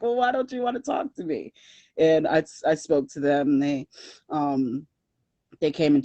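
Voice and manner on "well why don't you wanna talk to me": puzzled missionary. Retold or imitated. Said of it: "they were like".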